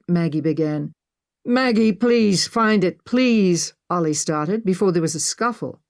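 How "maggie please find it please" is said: raised, drawn-out begging; desperate pleading. A narrator's reading. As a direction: plead slowly and urgently. Captive uncle begs his niece.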